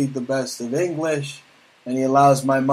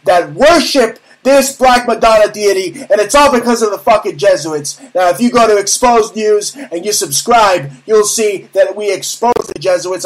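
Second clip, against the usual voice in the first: loud, raised-pitch tirade to the audience; real angry rant; 5 semitones higher, 6 dB louder than usual. Real, not acted. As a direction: rail against them at full force, hammering key words. Angry on-air rant exposing enemies.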